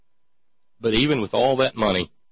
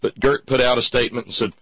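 normal talking voice

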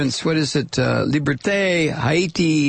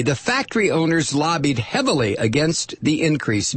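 ordinary talk, nothing marked

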